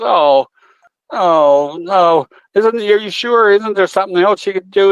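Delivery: hammed-up panic over the bird; mock distraught lady. Lady pleading with the vet to save her parakeet, mock distraught.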